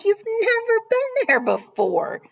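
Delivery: high, loud, incredulous father, no real laugh; amused disbelief. A narrator's reading. Father answering his reluctant daughter, amused disbelief. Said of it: "Mister Hartwell chuckled".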